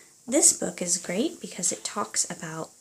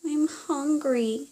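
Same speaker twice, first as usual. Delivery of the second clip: small, slow, higher whimper; 7 semitones higher, slower than usual. Acted. Lost mouse Henry complaining in the dark woods, mild whimper. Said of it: "he whimpered".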